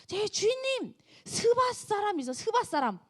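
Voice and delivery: high, alarmed servant's report. Acted. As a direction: blurt the news up high. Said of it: "사환들이 와서 얘기를 해요". (다급한 종의 목소리)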